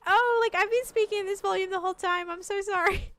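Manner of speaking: high, loud, sheepish apology; loud apologetic quote. Retold or imitated. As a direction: blurt the sorry out loud and high. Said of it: "I was like".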